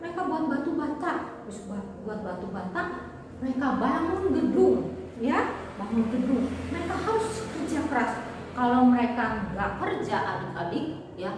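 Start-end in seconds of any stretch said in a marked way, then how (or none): none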